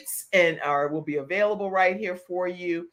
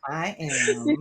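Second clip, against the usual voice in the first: smooth, measured mimicry of a guest; mock-elegant sultry reply; imitated exotic voice. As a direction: imitate her exotic delivery. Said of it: "you're like".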